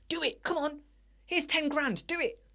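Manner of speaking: high, coaxing mock customer; pushy customer. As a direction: egg the dealer on in a high voice. Pushy customer urging dealer.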